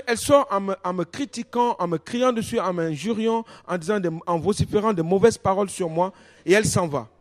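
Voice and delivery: ordinary delivery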